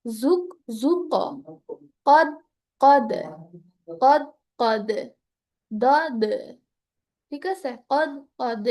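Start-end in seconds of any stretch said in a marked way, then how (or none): 0.0-1.0 জুক with the final ক bounced into an echo; qalqala echo demo. Shown as a demonstration. Teacher showing students the echoing ক in জুক. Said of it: "দুইটার মধ্যে পার্থক্য বোঝানোর জন্য এভাবে পড়ছি". 2.1-4.9 কদ with the দ bounced, louder; qalqala echo demo. Shown as a demonstration. Teacher demonstrating the echoing দ in কদ. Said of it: "এই যে একটা ইকো হচ্ছে".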